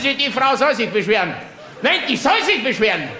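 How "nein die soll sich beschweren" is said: normal talking voice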